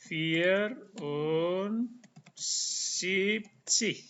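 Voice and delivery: drawn-out "Vierundsiebzig"; slow German number. Shown as a demonstration. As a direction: say "Vier-und-sieb-zig" syllable by syllable. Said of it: "Empat dan tujuh puluh".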